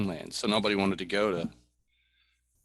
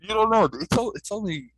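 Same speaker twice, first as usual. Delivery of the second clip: high loud laughing shout; 11 dB louder than usual. Real, not acted. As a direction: blurt it out loud. Guest yelling back.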